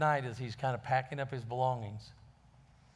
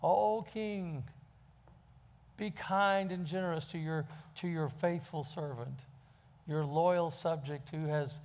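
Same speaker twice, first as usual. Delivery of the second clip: high, pleading whine of a beggar; pathetic beggar voice; 5 semitones higher than usual. Acted. Beggar pleading with the passing king, pathetic and whining. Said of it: "in his most pathetic voice".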